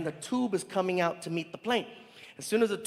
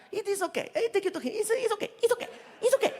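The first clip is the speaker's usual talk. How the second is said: put-on high, soothing foreign accent, laughing a little; Saudi driver; 13 semitones higher, faster than usual. Driver reassures the nervous comic in a high accent. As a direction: wave off his worry.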